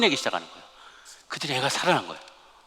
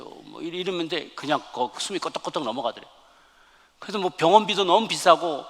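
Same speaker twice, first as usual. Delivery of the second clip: ordinary talk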